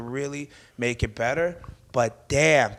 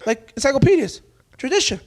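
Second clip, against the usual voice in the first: higher, louder indignant parent act; hammed-up loud objection; mock outrage; 10 semitones higher, 7 dB louder than usual. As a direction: protest like an appalled parent. Acting outraged parent in his bit.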